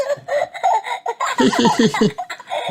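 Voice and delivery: long high-pitched piglet giggle. A narrator's reading. Peppa proud of her noisy music, giggling. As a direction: giggle away in a tiny high voice. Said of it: "laughs Peppa".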